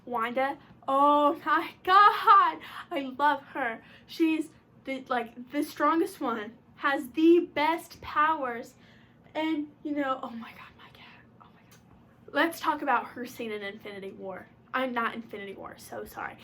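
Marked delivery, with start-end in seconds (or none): 0.9-3.8 loud, high, drawn-out fangirl swoon; gushing. Real, not acted. Fan overcome by love for Wanda, gushing loudly. 10.2-12.3 exasperated whisper; whispered aside. Real, not acted. Vlogger distracted by the cat mid-video, whispering.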